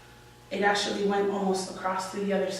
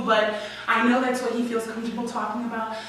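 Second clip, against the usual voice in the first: ordinary delivery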